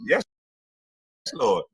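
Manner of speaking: ordinary talk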